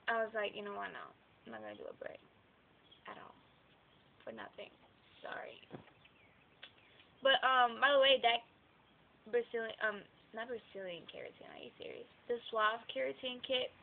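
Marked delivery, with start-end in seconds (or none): 0.5-5.6 much lower and softer, half to herself; quiet self-talk. Retold or imitated. Vlogger retelling her own decision about the braid, muttered quietly. 10.3-12.1 breathy whisper to herself; whispered aside. Real, not acted. Vlogger catching her own wrong product name, whispered aside. Correcting herself mid-sentence.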